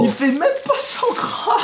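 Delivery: high, slow, outraged disbelief; can't believe how small it is; indignant disbelief. Real, not acted.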